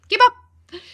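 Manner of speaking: high, loud playful shout; shouted comeback. Acted. Answering people who say she talks fast, playfully shouted.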